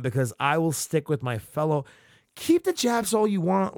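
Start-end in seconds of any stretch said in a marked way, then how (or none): 2.3-3.8 high, sneering parody of the line; mock his words back at him; mocking reread. Real, not acted.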